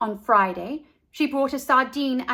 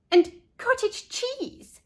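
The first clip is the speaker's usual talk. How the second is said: high, slow incredulous question; can't believe what she hears; disbelieving; 13 semitones higher, slower than usual. A narrator's reading. Leslie disbelieving.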